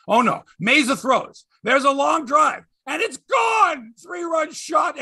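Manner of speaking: high, excited home-run call building to a shout; dismayed announcer's rising call; mock play-by-play. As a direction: call the long ball with dread, stretched out. Re-enacts play-by-play of homer.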